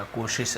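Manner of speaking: "कोशिश" with sh as in शक्कर; sh sound. Shown as a demonstration. Teacher pointing out the spelling, sh shown softly in "कोशिश". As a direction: say "कोशिश" softly, both sibilants as sh. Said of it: "शक्कर का श यहाँ पर भी आएगा".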